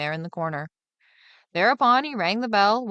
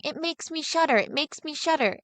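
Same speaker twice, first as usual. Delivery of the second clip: higher mock repetition of others' words; a hint of parroting the grown-ups; boy parroting what the listeners say, higher; mimicking the others; 8 semitones higher than usual. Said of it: "They are always saying".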